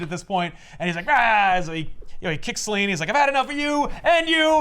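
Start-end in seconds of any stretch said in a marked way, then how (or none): 1.1-1.6 a hint of a louder villain roar; mock battle yell. Retelling Stan's second wind in the fight, mock battle yell. 3.1-4.6 higher and louder, a hammed-up snarl of rage; mock villain shout. Retelling Stan turning on both women, mock angry shout. Stan attacks Selina and Maggie.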